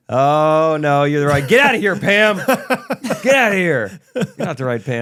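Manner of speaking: loud, laughing "get outta here"; laughing mock shooing. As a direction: shoo her off through laughter. Acted dismissal, laughing.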